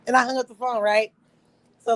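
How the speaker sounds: ordinary delivery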